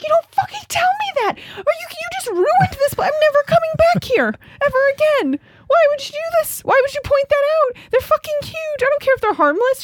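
very high, squealing mock tirade; outraged mock yell. Hiker scolds woman for pointing out snakes, high outraged squeal. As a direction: protest in a frantic, high-pitched acted rant.

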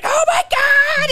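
high, drawn-out mock wail; loud, hammed-up cry of a flailing treadmill runner; impersonating struggling runners. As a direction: wail it slowly, way up high, in mockery. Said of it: "this is my fucking impersonation of them".

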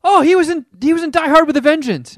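high, loud excited recognition. Retold or imitated. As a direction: blurt it out high and fast. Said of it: "I was like".

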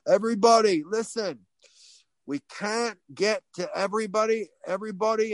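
put-on high Australian-accented crowd announcement. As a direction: put on an Aussie accent, calling everyone to listen. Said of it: "I'll stop with the accent".

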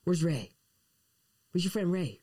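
high cooing pet voice. Real, not acted. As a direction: ask the dog sweetly. Talking to pet.

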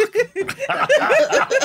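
shrieking laugh at his own failure; real laugh, high and loud; laughing at himself for failing to cry. Real, not acted. No tears come, laughs.

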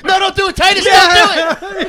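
high, loud pleading; hammed-up panicked yell; mock desperate shout. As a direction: cry out as if stopping a tragedy, in jest. Acted plea to stop kid.

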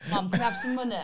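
high, loud mock whiny son; Ben pestering Mum. Imitating son asking Mum for money, needy and hammed-up. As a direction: badger Mum for cash in a put-on son voice. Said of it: "this is what he does".

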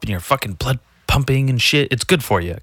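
usual speaking voice

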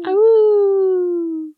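long high loud wolf howl. Pretend. Hosts howl goodbye like wolves.

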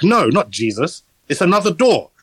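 put-on a light New Zealand accent, deadpan sermon. Retelling the priest's funeral speech in a mild New Zealand accent. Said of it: "with that New Zealand accent as well".

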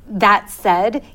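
ordinary delivery

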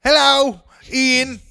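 high, loud cheery British greeting; mock British accent. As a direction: greet him like a Cockney shopkeeper.

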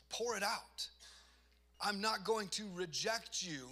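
forceful, insistent reassurance; urgent invitation. Retold or imitated. God's invitation voiced with force.

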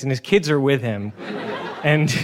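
ordinary delivery

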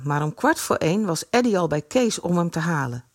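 usual speaking voice

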